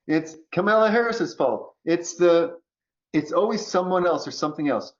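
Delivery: normal talking voice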